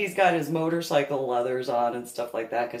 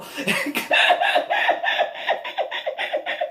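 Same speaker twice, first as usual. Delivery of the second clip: high, loud breathless laugh; high wheezing laugh; 4 dB louder than usual. Real, not acted. Loud high laugh at her own panic. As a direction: let the laugh squeak out high.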